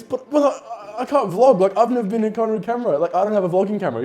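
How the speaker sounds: high-pitched mock protest, stammering at first, then rushing; flustered excuses. Acting out friend's panicked excuses.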